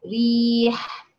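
ريح drawn out very slowly, its light ra held; tajweed demo word. Shown as a demonstration. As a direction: model ريح slowly with a thin ra. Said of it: "ورقق الراء إذا ما كسرت".

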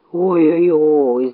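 slow, low moan of pain; drawn-out bear lament; bear crying out. A narrator's reading. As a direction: groan it long, as a hurt bear. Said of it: "закричал опять медведь".

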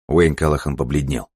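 ordinary delivery

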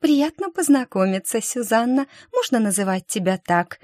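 high, sweet chirping voice; cartoon princess. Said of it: "красотка прощебетала".

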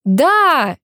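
bright excited squeal of a yes; squealing girls. Acted. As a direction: squeal it like a giddy party girl. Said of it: "визжат девчонки".

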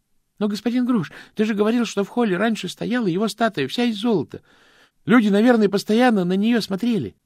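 put-on curious youth pressing an old man; eager young Stanley. Stanley pleads with Grosh. (юный Стэнли, с любопытством допытывается)

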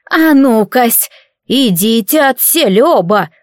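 put-on drawn-out order from a stern old granny. Old healer orders the two out, slow and commanding. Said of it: "грозно приказала бабуся".

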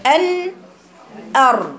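"أر" with the tongue tip pressed and a gap in the middle; teacher sounding out the ra. Shown as a demonstration. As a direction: model the isolated "أر" clearly. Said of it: "بقول إيه".

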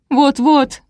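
high, plaintive agreement; moaning. A narrator's reading. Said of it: "простонала Олеся". (жалобно, со стоном)

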